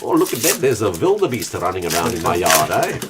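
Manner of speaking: mock-amazed South African farmer; South African accent. Pointing out a wildebeest in the yard, South African accent. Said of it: "I can actually do a pretty good South African accent".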